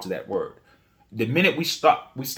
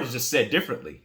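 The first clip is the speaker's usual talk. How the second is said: usual speaking voice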